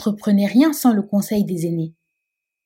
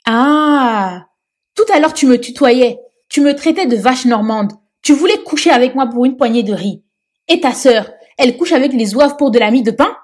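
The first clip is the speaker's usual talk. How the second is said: loud, higher furious accusation; angry tirade; 5 semitones higher, 6 dB louder than usual. Acted. Ndeye Touti confronting the police chief, loud angry tirade. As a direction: hurl the insults back at him.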